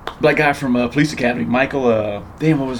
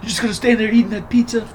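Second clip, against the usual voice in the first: higher, lazy stoner whine; higher put-on stoner voice; mock stoner; 8 semitones higher than usual. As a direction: tease him like a stoned buddy. Quoting Chong movie line.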